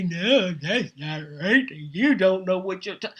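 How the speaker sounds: lightly mocked objectors with wobbly pitch; mocking naysayers. Host imitating people who will dispute her prediction, lightly mocking. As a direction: put on a light mocking voice for the doubters. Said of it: "people are gonna say".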